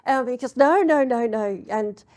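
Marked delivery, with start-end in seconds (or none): 0.6-1.6 loud, higher-pitched string of refusals; emphatic refusal. Retold or imitated. Street hustler stops the player taking the cash, loud emphatic refusal. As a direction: cut them off loudly, imitating the hustler.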